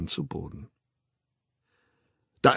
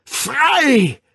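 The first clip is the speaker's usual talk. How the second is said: much higher, loud triumphant bellow; roaring; 7 dB louder than usual. Acted. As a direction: roar it out like a liberator. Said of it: "brüllte der Wilde".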